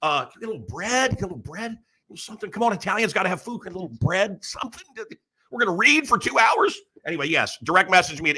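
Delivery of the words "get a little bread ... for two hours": higher-pitched, incredulous comedian's plea; Sebastian's comic complaint. Retold or imitated. Comedian griping about no food at Passover, incredulous complaint. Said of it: "This is Sebastian. This is not Mancow".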